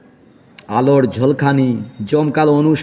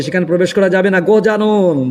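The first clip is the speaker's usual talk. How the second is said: usual speaking voice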